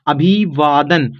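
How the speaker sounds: slow, drawn-out अभिवादन, broken as अभी-वादन; halting misreading. Shown as a demonstration. Teacher sounds out a learner's guess, halting misreading. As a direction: read अभिवादन haltingly, stretching each part. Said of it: "जैसे आपने गलत सही पढ़ा".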